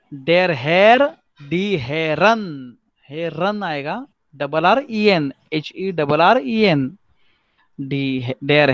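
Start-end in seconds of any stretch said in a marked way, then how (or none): 1.5-2.8 very slow: Herren with -en; teacher modelling the plural; model pronunciation. Shown as a demonstration. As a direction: stretch die Herren out. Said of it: "teacher models plural".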